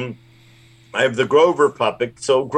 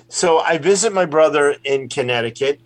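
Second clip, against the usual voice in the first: usual speaking voice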